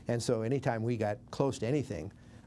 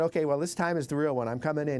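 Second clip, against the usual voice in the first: higher, louder retold Southern pilot; Southern drawl; 5 semitones higher, 4 dB louder than usual. Pilot announcing his real bomb run, light Southern drawl. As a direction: imitate a Southern pilot calling out, lightly accented. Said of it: "in his Southern drawl".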